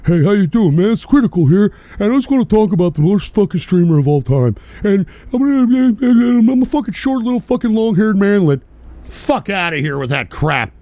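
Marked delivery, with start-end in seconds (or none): 0.1-8.6 mock monotone streamer. Streamer mocking a rival as a monotone bore, imitation. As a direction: parody a dull streamer. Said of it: "talk like some monotone jerk-off".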